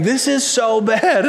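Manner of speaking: put-on high, slow, drawn-out child's whine; whiny child. Daughter whining to herself in the other room, high and drawn out. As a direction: whine it like a four-year-old over a broken toy. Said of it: "rolling around on the ground saying".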